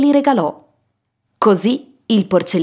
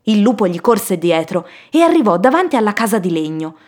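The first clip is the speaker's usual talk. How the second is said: ordinary delivery, nothing marked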